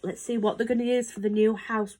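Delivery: plain reading voice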